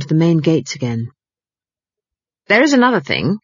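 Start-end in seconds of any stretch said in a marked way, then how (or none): 2.5-3.4 lightly voiced old witch, a touch higher; a hint of a mysterious fortune teller; Granny's voice. Granny telling the housekeeper's fortune, lightly voiced. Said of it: "she added".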